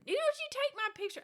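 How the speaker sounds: high, loud mama scolding; imitating mama's sharp protest; mock stern mama. Mother objecting to having her picture taken, high and sharp.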